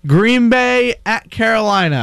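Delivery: slow, measured dictation. Shown as a demonstration. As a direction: spell out each matchup at writing-down speed. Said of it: "Go slower".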